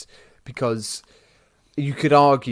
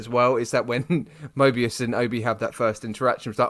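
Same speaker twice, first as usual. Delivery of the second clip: normal talking voice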